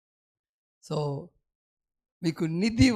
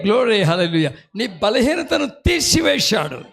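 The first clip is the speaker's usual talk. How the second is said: fiery preaching; 5 dB louder than usual. Acted. Preacher rousing the congregation, loud shouting. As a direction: preach with full force and shouts.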